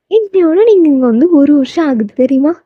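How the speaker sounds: heroine's high, lilting question; voiced heroine. Heroine announces the anniversary to Taeyang.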